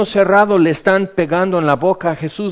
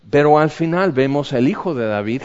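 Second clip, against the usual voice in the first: normal talking voice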